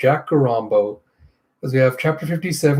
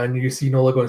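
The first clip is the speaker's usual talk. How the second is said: ordinary delivery, nothing marked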